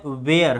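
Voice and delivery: वेयर as ve-ar with sounded r; common mispronunciation. Shown as a demonstration. Teacher showing how many read it, वेयर with r pronounced. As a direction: rhyme वेयर with wear, r heard. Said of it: "हम लोग ऐसे ही पढ़ते हैं".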